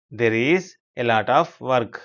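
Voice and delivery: very slow, with gaps between word groups; chunked repeat. Shown as a demonstration. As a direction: spell it out phrase by phrase for learners. Teacher repeats it slower, in chunks.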